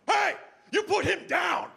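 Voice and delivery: high, shouted heroic order; mock-heroic yell to stop a fight; commanding rescuer. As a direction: call out a sudden command. Rodman orders bouncer to stop.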